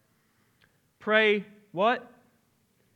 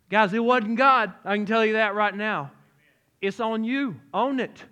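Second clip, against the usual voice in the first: louder, higher, pressing the point hard; emphatic preaching; 4 semitones higher, 6 dB louder than usual. Real, not acted. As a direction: preach it with force. Preacher driving the point home.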